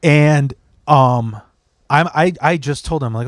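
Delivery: ordinary talk, nothing marked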